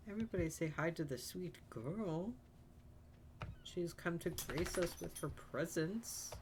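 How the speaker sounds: high, lilting coo to a sweet little one; cooing pet greeting. Real, not acted. Streamer welcoming Shadow the sweet girl, cooing. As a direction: coo adoringly over a pet.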